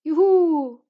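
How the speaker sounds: high, loud whoop of joy; joyful cheer. A narrator's reading. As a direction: let out a long, freed cheer. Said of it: "Rotkäppchen freute sich sehr".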